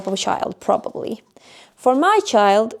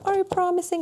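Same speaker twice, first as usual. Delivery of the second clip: put-on high, soft, anxious child's question; pleading child; 8 semitones higher, 6 dB quieter, faster than usual. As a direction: ask like a scared kid needing comfort. Daughter pleading with mom.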